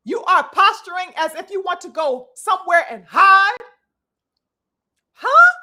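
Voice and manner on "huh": a sharp, much higher and louder huh; incredulous. Real, not acted. Asking the fighter why he stayed silent, loudly incredulous. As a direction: throw out a stunned huh.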